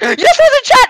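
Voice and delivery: high, loud excited squeal. Retold or imitated. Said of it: "I was like".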